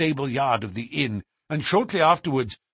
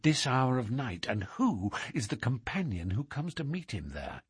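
hushed; 2 semitones lower, 7 dB quieter than usual. Acted. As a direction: keep it down while they watch the inn. Hidden watchers talking low.

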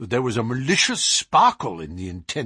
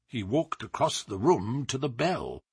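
usual speaking voice

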